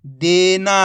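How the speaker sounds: whole word in one joined, drawn-out piece; teacher's blended model reading; syllables blended. Shown as a demonstration. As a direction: join the sounds into one loud, clear word. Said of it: "तो मिलके बन जाएगा".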